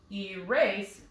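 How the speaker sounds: "Erase" as ee-RASE, accent on the end; "Erase" with its second syllable stressed. Shown as a demonstration. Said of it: "sounds right".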